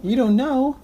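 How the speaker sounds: high incredulous question; surprised. Real, not acted. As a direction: sound amazed he doesn't know.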